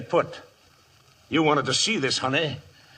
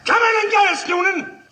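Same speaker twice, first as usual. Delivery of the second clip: defiant shout; 13 semitones higher, 4 dB louder than usual. Acted. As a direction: shout it high and loud through the walls. Outlaw defies the marshal outside.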